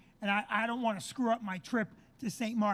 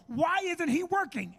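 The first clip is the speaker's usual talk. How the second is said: higher, drawn-out indignant question; exasperated complaint; 6 semitones higher, 3 dB louder, slower than usual. Retold or imitated. Traveler griping near a TSA agent, exasperated complaint. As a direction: complain so the agent can hear.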